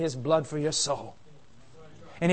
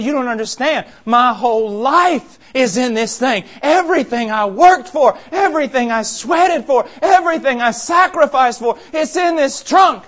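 rich man begging louder with every line; loud desperate plea; 6 semitones higher, 8 dB louder than usual. Acted. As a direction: plead for the trunk, building. Rich man pleading with angel.

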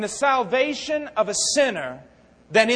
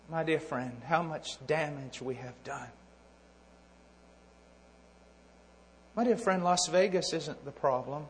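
much softer, lower, drawing in; hushed earnest appeal; 6 semitones lower, 7 dB quieter than usual. Acted. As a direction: plead gently with the listener. Quiet, intimate appeal.

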